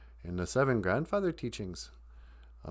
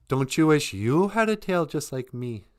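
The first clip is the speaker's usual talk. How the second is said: higher, louder gloating; boastful beaver; 6 semitones higher, 6 dB louder than usual. Acted. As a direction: rub it in.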